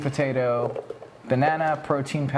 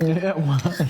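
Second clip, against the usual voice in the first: laughing, louder and higher; laughing disbelief; 4 semitones higher, 3 dB louder than usual. Real, not acted. Friend laughing that she never had fluff, laughing disbelief.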